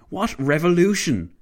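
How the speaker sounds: loud, high challenge; indignant bark. A narrator's reading. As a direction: bark it back in disbelief. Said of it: "Clavel barked".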